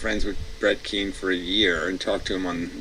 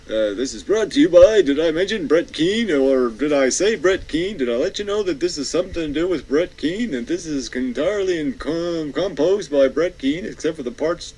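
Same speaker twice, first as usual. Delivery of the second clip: mock self-promoting host; 7 semitones higher, 5 dB louder than usual. Mocking Brett Keane's self-promotion.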